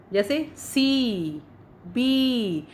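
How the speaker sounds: सी and बी with the ee drawn out very long; सी, बी stretched, slow long vowel; extra long ee. Shown as a demonstration. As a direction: hold the ee in सी slowly. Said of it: "और भी ज़्यादा लंबा खींच रहे हैं".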